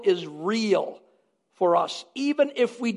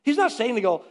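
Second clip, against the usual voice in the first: ordinary delivery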